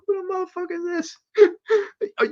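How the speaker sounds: very high, cracking squeal of disbelief; mock squeal. Imitating his own reaction to the comment, mock squeal. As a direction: shriek it like you can't believe it. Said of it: "I was like".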